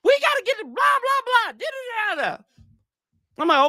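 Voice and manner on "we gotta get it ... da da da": higher-pitched mock angry rant, shouted; mad player rant. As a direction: imitate a fed-up player yelling at his team. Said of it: "He was mad".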